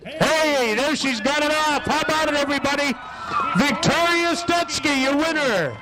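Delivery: high ringing call to the crowd; announcer call. Real, not acted. Track announcer hyping the crowd as she gets her helmet off, high and ringing. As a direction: rouse the grandstand for her.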